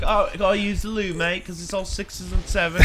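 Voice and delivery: put-on breezy parody of a British accent; Don Cheadle. Spouting generic British slang, mock British accent. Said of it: "generic British slang".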